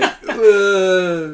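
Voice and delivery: loud, laughing "ugh" of contempt; mock disgusted groan. Miming a big fuck you to his friend, with a loud laughing groan. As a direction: blurt a scornful groan through laughter.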